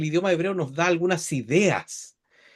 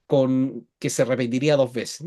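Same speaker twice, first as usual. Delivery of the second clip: usual speaking voice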